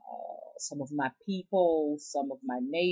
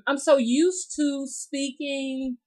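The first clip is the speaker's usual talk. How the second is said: southern drawl; 10 semitones higher, 5 dB louder, slower than usual. Shown as a demonstration. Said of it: "in that drawl".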